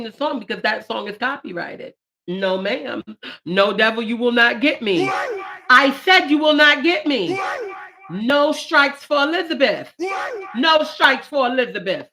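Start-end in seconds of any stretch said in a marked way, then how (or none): 3.4-12.1 loud, high rebuke of the devil; defiant shout. Real, not acted. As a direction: drive the devil off loudly.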